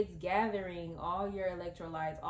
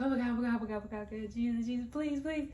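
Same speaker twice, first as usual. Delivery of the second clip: hammed-up frantic inner prayer; mock panicked praying; 3 semitones higher, much faster than usual. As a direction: plead in a mock panic, phrases running together. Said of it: "In my head, I'm just like".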